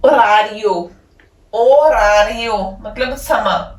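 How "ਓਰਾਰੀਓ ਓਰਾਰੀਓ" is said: ਓਰਾਰੀਓ said slowly as oh-rah-REE-oh, falling; teacher modelling the word; slow Italian word demo. Shown as a demonstration. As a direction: draw out ਓਰਾਰੀਓ for learners. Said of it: "Italian ਦੇ ਵਿੱਚ ਕੀ ਕਵਾਂਗੇ".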